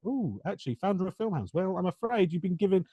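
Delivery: politely dismissive pretend gatekeeper; imagined Disney official. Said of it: "they're like".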